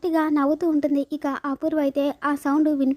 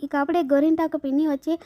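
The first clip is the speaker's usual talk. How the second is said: normal talking voice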